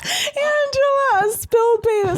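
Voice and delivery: high squealing laugh through the words; cracking up while repeating the joke. Real, not acted. Laughing at her cohost's spaghetti line.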